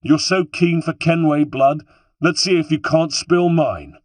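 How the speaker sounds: high, loud, defiant dare; impassioned defiant shout; shouted challenge. A narrator's reading. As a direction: goad the enemy with a shout. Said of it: "I shouted".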